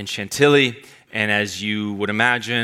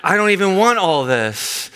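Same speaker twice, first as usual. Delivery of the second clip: panicked younger self; 6 semitones higher, slower than usual. Retold or imitated. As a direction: cry out like you've ordered too much. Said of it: "And I was like".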